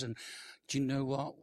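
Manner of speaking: ordinary talk, nothing marked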